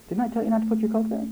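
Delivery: imitating a fed-up parent, quick and high; mock exasperated parent. Parent scolding a child about the Coke, mock exasperated.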